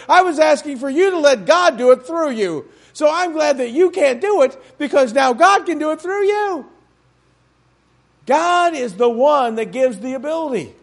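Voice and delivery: normal talking voice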